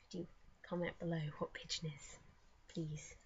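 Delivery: near-whispered, low request; hushed plea. Real, not acted. Vlogger asking viewers for a spelling, hushed. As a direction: drop to a small, sheepish near-whisper.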